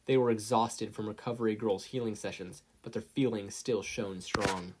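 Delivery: plain reading voice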